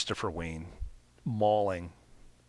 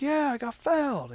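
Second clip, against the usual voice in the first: mad player; 5 dB louder than usual. Retold or imitated. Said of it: "He was mad".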